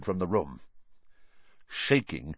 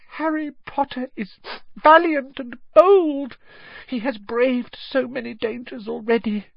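high, squeaky devoted elf; a high elf voice pleading earnestly; house-elf Dobby; 13 semitones higher than usual. Acted.

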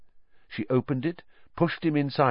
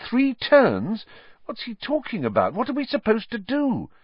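high, baffled questions; bewildered; 13 semitones higher than usual. A narrator's reading. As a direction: sound completely lost. Harry baffled.

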